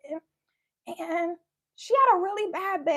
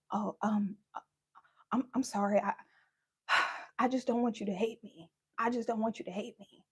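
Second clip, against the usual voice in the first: much lower, softer, hesitant dad; guilty mumbling dad; 10 semitones lower, 9 dB quieter than usual. Retold or imitated. Dad pleading with daughter not to hate him, low mumble. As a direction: mumble it like a caught, pitiful father.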